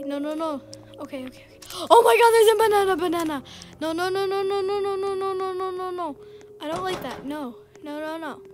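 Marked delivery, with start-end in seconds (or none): 1.9-3.4 loud, fast panicked warning; loud frightened alert; alarmed shout. Real, not acted. Spots the banana. 3.8-6.2 loud repeated pleading nos; nervous string of nos fading down; panicked nos. Real, not acted. Hiding from the banana.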